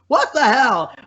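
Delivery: high, loud startled yelp; startled outburst. Retold or imitated. Dad startled by child, high loud yelp. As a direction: jump out of your skin and yelp.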